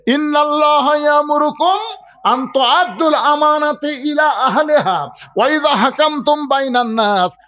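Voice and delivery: high, loud proclamation; full-force preaching. Real, not acted. Preacher announcing the Qur'an's judgment, full-force preaching. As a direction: proclaim it to the crowd on a sustained high pitch.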